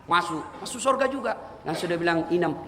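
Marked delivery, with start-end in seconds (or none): none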